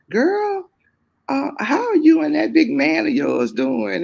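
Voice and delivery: hammed-up friend calling out girl; friend's greeting. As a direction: gush it like running into an old friend. Old friend greeting her at funeral.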